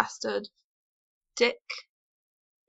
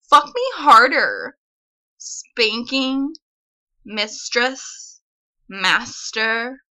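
put-on Valley girl voice, louder, with lilting rises; 4 dB louder, slower than usual. Shown as a demonstration. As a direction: ham up a ditzy Valley girl upspeak. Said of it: "I can do a really, apparently attractive Valley girl".

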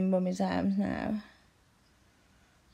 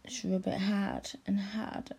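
breathy intimate whisper; hushed whispered instruction; 5 dB quieter than usual. Acted. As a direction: whisper it close in his ear.

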